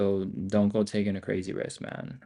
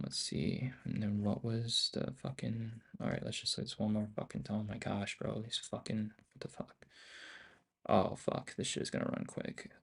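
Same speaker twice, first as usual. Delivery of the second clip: muttering under breath; 11 dB quieter than usual. Real, not acted. Muttering to self over chart.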